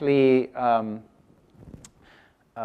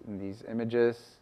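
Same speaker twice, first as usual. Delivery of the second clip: ordinary delivery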